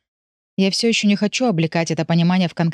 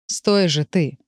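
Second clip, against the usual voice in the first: quiet confident growl; slower than usual. A narrator's reading. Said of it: "Тихо, но уверенно рычит он".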